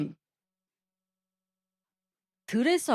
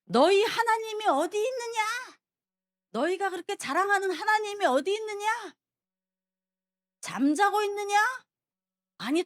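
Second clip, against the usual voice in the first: raised high mocking challenge, pitched up; a preacher hamming up a giant's boastful roar; Goliath bellowing taunt; 5 semitones higher than usual. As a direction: taunt the army like a giant shouting across the valley. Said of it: "소리를 지르면서".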